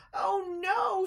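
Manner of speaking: high loud terrified squeal. A narrator's reading. Christopher swept into darkness, high squeal. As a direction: squeal as you're swept away. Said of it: "squealed Christopher".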